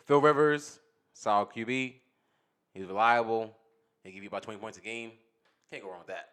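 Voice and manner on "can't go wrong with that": normal talking voice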